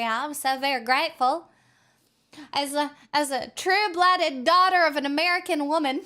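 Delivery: hammed-up American patriot voice; mock American accent. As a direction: declare it like a true-blooded Yank. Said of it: "whip out this accent".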